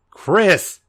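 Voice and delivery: high, strained cry of a name; weakening voice. A narrator's reading. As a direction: let the voice give out. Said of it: "his voice obviously weakening".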